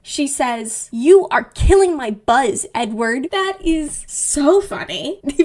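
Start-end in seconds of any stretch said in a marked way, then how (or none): 0.9-3.3 slow, loud, emphatic complaint; exasperated, drawn-out telling-off; annoyed bride. Retold or imitated. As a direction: lean hard on each word.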